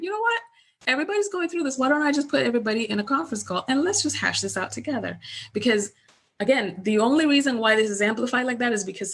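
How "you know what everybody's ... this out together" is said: high-pitched, mildly animated retold proposal; acted-out pep talk, pitched up; rallying suggestion. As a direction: start high, ease down as you propose a fix. Retold pep talk to worried clients.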